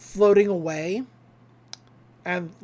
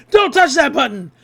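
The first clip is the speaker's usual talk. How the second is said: shouted warning; 5 semitones higher, 9 dB louder than usual. Retold or imitated. Stan bursting in.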